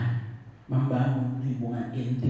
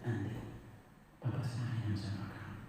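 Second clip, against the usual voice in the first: soft, tender words to a son; softly reassuring father; gentle; 3 semitones lower, 10 dB quieter than usual. Retold or imitated. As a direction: speak softly, like calming your boy. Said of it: "Saya ngomong dengan lembut sekali".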